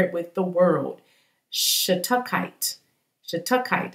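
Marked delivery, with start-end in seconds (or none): 1.5-3.9 Shatukite said slowly, stressed on the second syllable; tentative pronunciation. Shown as a demonstration. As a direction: sound out Shatukite as shuh-TUCK-ite. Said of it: "I think that's how you say it".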